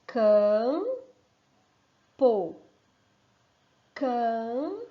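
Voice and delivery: "Campo" split into its two beats, with a rising end; syllable counting. Shown as a demonstration. Teacher counting the syllables with the children, sounded out. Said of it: "Ó, vamos contar?".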